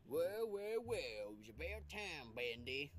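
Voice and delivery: villain voice. Acted. Villain gang greets Bendy.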